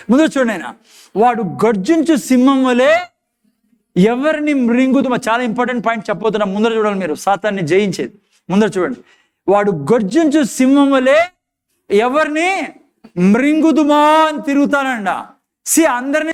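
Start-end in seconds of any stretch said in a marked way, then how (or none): 1.2-5.2 dramatic scripture reading. Acted. Preacher reads verse dramatically. 9.5-15.3 dramatic scripture reading. Acted. Verse read with dramatic emphasis.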